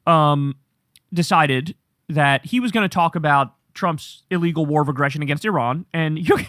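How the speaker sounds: ordinary talk, nothing marked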